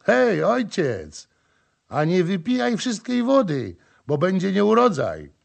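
jolly call. Acted. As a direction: call out a joke to someone far off. Rybak woła żartobliwie do chłopa. (wesołe, głośne wołanie)

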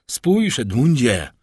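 loud, slow anguished call. Acted. Prosecutor showing Edmund two corpses, anguished call. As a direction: call out, drawing the words out. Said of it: "Zawołał prokurator".

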